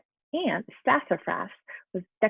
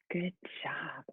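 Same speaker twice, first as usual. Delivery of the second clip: whispered praise; 5 semitones lower, 12 dB quieter than usual. Real, not acted. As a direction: whisper it like a secret well done. Teacher whispers praise to class.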